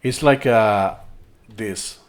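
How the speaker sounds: ordinary talk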